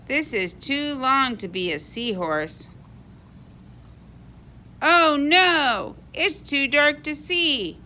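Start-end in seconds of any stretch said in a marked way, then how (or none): none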